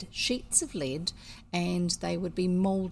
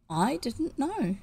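slow, higher, meek little admission; tame; 7 semitones higher, slower than usual. Acted. Brother to his crying sister, slow and tame. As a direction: back down meekly, drawing it out. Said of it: "said Cyril tamely".

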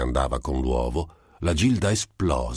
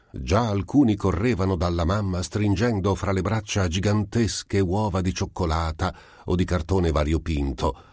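usual speaking voice

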